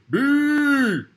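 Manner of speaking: a silly high buzzing bee cry. Acted. Buzzing word play climax.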